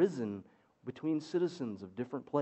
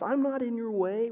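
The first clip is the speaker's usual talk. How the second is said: put-on indignant child voice; 7 semitones higher, 6 dB louder than usual.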